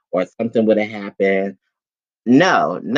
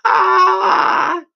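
high, loud rollercoaster scream; reenacted scream; 5 dB louder than usual. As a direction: imitate screaming on a big drop. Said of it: "I was screaming, y'all".

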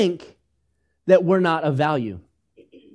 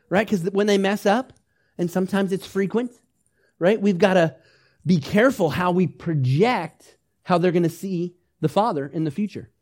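normal talking voice

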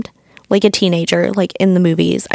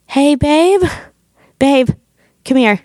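higher-pitched call to a partner; 6 semitones higher than usual. Retold or imitated. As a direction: imitate beckoning your babe over. Calling partner through the door.

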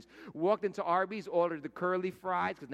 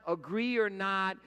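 usual speaking voice

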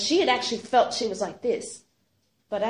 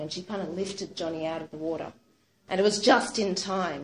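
plain reading voice

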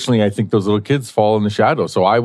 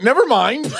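awkward retreat. Retold or imitated. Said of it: "I was like".

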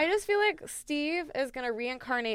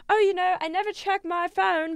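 put-on light Aussie accent, pitched up; a hint of Australian, a bit higher; mock Australian accent; 8 semitones higher than usual. Robert Irwin brushing off a fan, in a light Australian accent. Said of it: "is that British or is that Australian".

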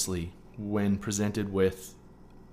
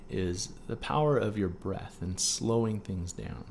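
ordinary talk, nothing marked

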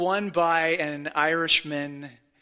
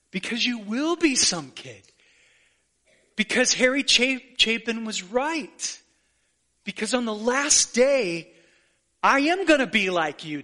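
emotional climax; 5 semitones higher than usual. Real, not acted. Sermon climax, rising emotion.

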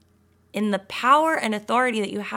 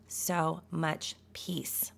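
slow, much softer whisper; 4 semitones lower, 10 dB quieter, much slower than usual. Real, not acted. Encouraging listeners about peace, soft slow whisper. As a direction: let it fall away into a whisper.

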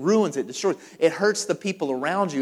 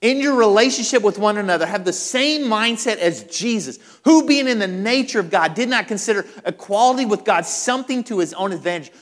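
loud, raised preaching delivery; forceful scripture reading; 5 semitones higher, 6 dB louder than usual. Acted. Preacher quoting Paul's letter to the church, forceful declamation. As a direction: declaim the verse with full pulpit force.

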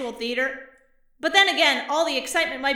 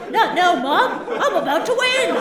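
whiny son; 3 semitones higher, 6 dB louder than usual. Retold or imitated. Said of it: "But he's like".

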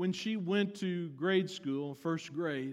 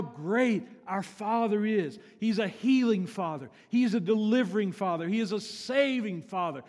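loud, raised preacher voice; full-force preaching; 4 semitones higher, 6 dB louder than usual. Real, not acted. Preacher extols the Father to the church, with full force. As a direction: drive each line home to the congregation.

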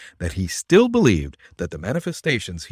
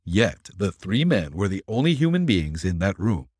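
plain reading voice

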